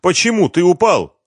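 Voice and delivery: high, loud alarmed woman; agitated wife's question; wife asking. A narrator's reading. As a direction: ask anxiously in a high voice. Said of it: "Супруга Семёна бросилась к мужу".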